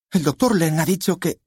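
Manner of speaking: high-pitched, urgent objection; daughter's protest. A narrator's reading. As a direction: object in a high voice, cut off midway.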